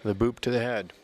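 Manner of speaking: live bout commentary, rising excitement; play-by-play. Real, not acted. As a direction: call the touches as they land. Live match calls.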